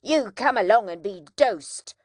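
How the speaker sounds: loud grim order; awful command. A narrator's reading. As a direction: drag him off with a stern command. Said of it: "the awful words".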